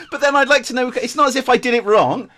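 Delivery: high, loud, incredulous through laughter; laughing protest. Real, not acted. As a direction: protest while still laughing. Amused protest at absurd direction.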